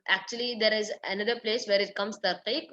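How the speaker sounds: ordinary talk, nothing marked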